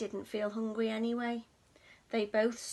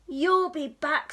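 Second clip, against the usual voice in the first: stormy; 2 semitones higher, 8 dB louder than usual. Acted. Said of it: "stormed the cat".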